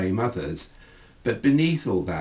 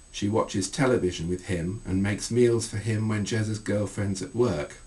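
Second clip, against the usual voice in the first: plain reading voice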